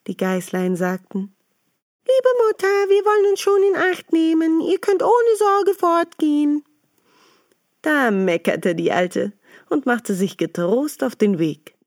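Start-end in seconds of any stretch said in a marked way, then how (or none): 2.1-6.6 put-on much higher, louder small-kid voices; sweet, eager voices of little goats promising to behave. Little goats reassuring their mother, high and bright.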